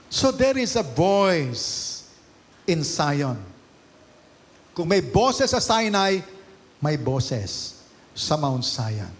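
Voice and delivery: loud, slow, drawn-out proclamation; climactic preaching. Real, not acted. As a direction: build the sermon to its peak.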